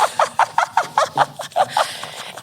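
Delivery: breathy high laugh after the bit; high squeaky laugh. Real, not acted. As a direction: giggle high at own impression.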